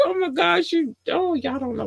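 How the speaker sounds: high squeal of joy; overwhelmed delight. Real, not acted. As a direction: overcome with happiness. Overwhelmed by listener countries.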